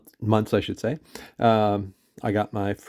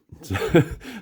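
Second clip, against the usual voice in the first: embarrassed laugh; 6 semitones higher than usual. Real, not acted. Laughing at own mispronunciation.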